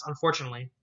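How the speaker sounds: ordinary delivery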